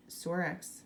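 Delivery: usual speaking voice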